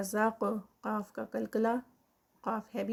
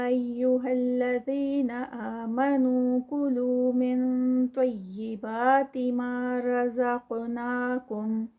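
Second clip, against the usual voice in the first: slow, louder melodic chant; Quran recitation; 4 dB louder, much slower than usual. Shown as a demonstration. Teacher reciting the verse aloud, slow and melodic. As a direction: sing out the verse with long madd. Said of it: "recites the next verse".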